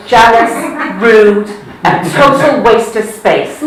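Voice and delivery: normal talking voice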